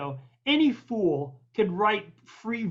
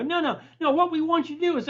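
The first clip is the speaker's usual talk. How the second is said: high-pitched mock cutting-in; imitated insistence; 8 semitones higher, faster than usual. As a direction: brush off the objection, acting the pushy caller. Show's man cuts in to insist.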